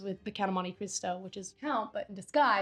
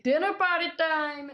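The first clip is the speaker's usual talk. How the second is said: excited announcement; 7 semitones higher, 4 dB louder than usual. Real, not acted. Gleeful announcement of next scene.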